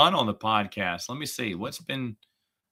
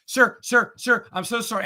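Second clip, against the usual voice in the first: pleading voice; 9 semitones higher, 3 dB louder than usual. Retold or imitated. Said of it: "she followed me out there".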